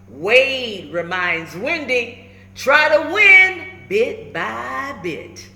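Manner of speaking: put-on lively, rhyming read-aloud for kids; storybook read-aloud. A narrator's reading. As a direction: bounce the rhymes like a picture book. Reads picture book aloud.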